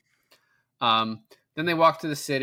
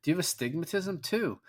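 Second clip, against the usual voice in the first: mock eager; 6 semitones higher, 4 dB quieter than usual. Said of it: "she's like".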